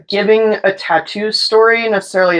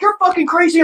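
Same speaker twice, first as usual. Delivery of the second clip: alarmed accusation; 10 semitones higher than usual. Retold or imitated. Man acted out, backing off.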